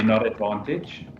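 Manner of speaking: ordinary talk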